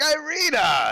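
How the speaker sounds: high, loud call for someone missing. Acted. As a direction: holler the name as a confused old man. Old man calls for his granddaughter.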